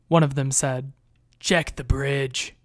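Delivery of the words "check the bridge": slow, distant soldier voice; gruff soldier order. A narrator's reading. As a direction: bark the order slowly from outside. Soldier outside gives order.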